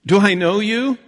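acted farmer sizing up a stranger; puzzled farmer. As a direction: greet a stranger uncertainly, pitch climbing on the question. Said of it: "And the farmer goes".

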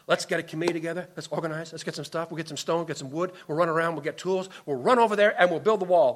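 quick, hushed rattle of busy plans; bustling planners. Acted. Committee rushing to rebuild the wall, hurried and busy. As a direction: rattle off tasks like an eager organizer.

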